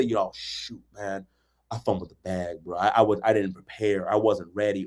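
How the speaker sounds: low, soft, casual confession; mumbled humble admission; owning a mistake. Retold or imitated. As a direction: admit you fumbled it, low and easy. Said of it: "come back and say".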